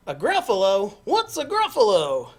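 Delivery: loud, high fox voice; baffled fox. Acted. Fox asks mouse about the Gruffalo, loud and baffled. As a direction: demand it loudly as the fox.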